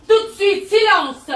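sharp loud command for silence. Acted. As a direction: snap the room into silence.

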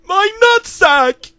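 shrill high scream of pain, very loud. Acted. Mailman screams after his package is kicked, very loud. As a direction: howl it out in agony. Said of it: "he screamed".

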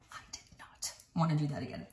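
hushed, fast whisper; whispered aside. Real, not acted. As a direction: whisper it like a confession. Dreading another disassembly.

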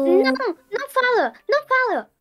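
high, urgent panicked plea; frantic high warning to a friend; urgent alarm. Acted. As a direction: cut her off before she speaks. Stopping friend from speaking wish.

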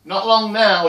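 high, loud, pre-empting reply; loud parental announcement; dad calling out. Acted. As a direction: call it out before the kid can ask. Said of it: "when he beat me to it".

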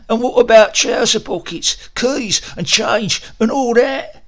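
high, loud querying clerk; second man. Acted. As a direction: press for details.

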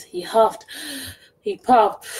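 plain reading voice

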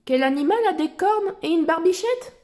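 put-on bright, high child explorer voice; Dora voice. Reading Dora's lines to little listeners, in a high cheerful voice. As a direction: lift the voice like Dora talking to kids.

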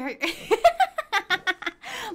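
high burst of giggles; giggling laugh. Real, not acted. Streamer amused about her horse, giggling laugh. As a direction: crack up mid-thought.

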